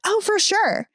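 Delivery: high, sing-song "Oh, for sure"; mock the coworker's breezy yes; imitating coworker. Mocking coworker's breezy reply to the boss. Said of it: "it's always in that tone too".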